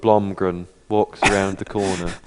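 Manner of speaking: low, glum robot monotone; depressed robot voice. Pretend. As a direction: drone it like a gloomy robot. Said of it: "You sound like the depressed robot".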